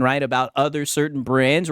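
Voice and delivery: usual speaking voice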